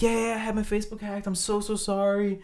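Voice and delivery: high, quick, sheepish apology; hurriedly sorry, a light imitation; family friend apologizing for his hacked account; apologetic friend. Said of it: "he's immediately like".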